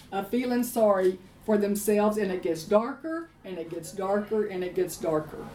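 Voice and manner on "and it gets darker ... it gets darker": a dark repetition dropping quieter each time; fading darker. Acted. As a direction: lower and softer on each darker. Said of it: "repetition sinking lower".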